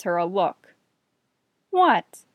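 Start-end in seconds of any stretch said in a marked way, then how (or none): 1.7-2.2 a sharp, high, louder mock-innocent what; defensive what. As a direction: snap it back like you've been caught. Jesse glares at teasing Donna.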